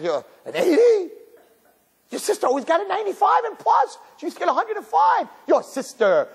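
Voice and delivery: high, disdainful nagging; scornful parent. Acted. Parent comparing eighty to sister's marks, scornful nagging. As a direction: rub it in with mock outrage.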